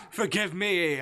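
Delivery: loud, high, amused apology; bursting with laughter. Acted. Demon apologising after his joke, loudly amused. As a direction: cry it out on the tail of a laugh. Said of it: "he burst into laughter".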